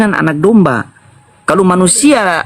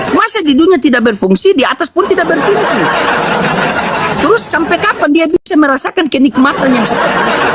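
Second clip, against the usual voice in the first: higher, chuckling through the words; laughing mockery; 6 semitones higher than usual. Real, not acted. Debater ridicules the pastor's view of heaven, laughing. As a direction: laugh at the idea while asking. Mocking the opponent's claim.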